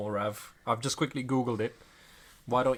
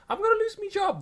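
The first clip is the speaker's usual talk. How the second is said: very high squeaky meme voice; high-pitched meme impression. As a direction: squeak it like the viral video. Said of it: "that's from, like, a video".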